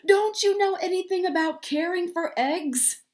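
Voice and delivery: high, loud scolding. A narrator's reading. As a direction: scold like an upset mother. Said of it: "Duck scolded".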